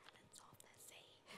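very soft, voiceless whisper; hushed, joking mimicry of a prayer; mock prayer whisper. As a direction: whisper along mockingly behind someone praying. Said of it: "like this, and make a joke of it".

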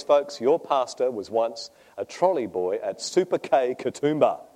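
ordinary talk, nothing marked

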